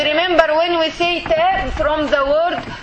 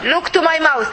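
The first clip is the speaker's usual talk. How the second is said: ordinary talk, nothing marked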